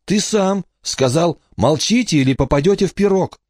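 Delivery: put-on very high child's voice; accusing little girl. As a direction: throw it back at the giant, high-pitched. Emma argues back in child voice.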